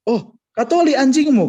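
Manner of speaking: high, loud sudden change of heart; hammed-up exclamation at the punchline; mock surprised priest. (pastor tiba-tiba berubah sikap, berseru keras dengan nada tinggi)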